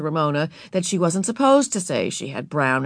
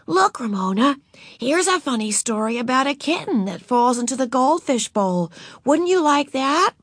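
coaxing; 7 semitones higher than usual. A narrator's reading. Beezus coaxing.

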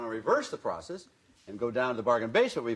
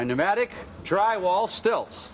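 proud reveal; 7 semitones higher, slower than usual. Acted. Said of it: "proud product unveiling".

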